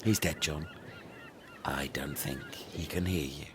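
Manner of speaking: usual speaking voice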